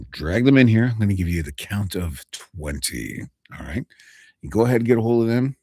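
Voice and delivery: ordinary talk, nothing marked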